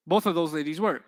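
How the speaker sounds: usual speaking voice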